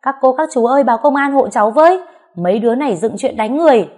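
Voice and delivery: high, loud plea to the neighbours; shouting for help. A narrator's reading. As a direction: cry out for help while fighting back. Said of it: "Tôi vừa chống trả vừa hét".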